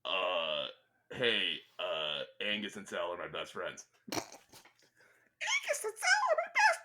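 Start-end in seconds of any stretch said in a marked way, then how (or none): 4.1-6.8 sped-up squeaky high chipmunk voice; pitched way up like a cartoon chipmunk. Shown as a demonstration. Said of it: "pitch up your yak back so it sounds like you're a chipmunk".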